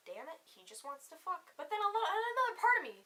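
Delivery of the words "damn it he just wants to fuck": muttered, resigned disappointment; cynical inner voice. Retold or imitated. Her cynical side reacting to his overnight plan, softly resigned. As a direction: grumble it softly to yourself. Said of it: "part of me was like".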